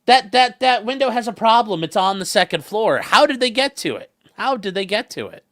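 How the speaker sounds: high, loud skeptical objection; a hint of a doubting viewer; mock skeptic viewer. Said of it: "some of you might say".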